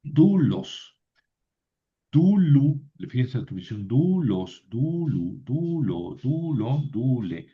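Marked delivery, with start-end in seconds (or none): 0.0-2.8 DU-los, DU-lu, first syllable drawn out; teacher modelling "Dulos" with a long, stressed first syllable; penultimate stress. Shown as a demonstration. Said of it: "La segunda palabra es paroxítona". 3.9-7.5 DU-los, DU-lu, DU-lo, first syllable held; every "dulon" form stressed on a long first syllable; penultimate stress. Shown as a demonstration. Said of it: "La segunda palabra es paroxítona".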